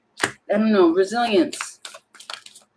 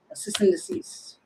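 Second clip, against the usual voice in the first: whispered to herself; a whispered repeat of the odd phrase; 5 dB quieter than usual. Real, not acted. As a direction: murmur it unvoiced, puzzled. Repeats the word under breath.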